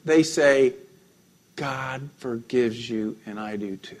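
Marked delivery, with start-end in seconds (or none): 1.5-4.0 slow, hushed and tender; softly spoken absolution; soft forgiveness. Retold or imitated. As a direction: say it gently, like forgiving a friend. Tender words of forgiveness quoted.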